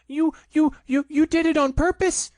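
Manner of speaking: high, loud sputtered accusation; spluttering. A narrator's reading. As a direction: splutter through soap. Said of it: "spluttered Piglet".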